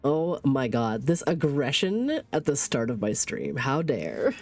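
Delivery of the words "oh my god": normal talking voice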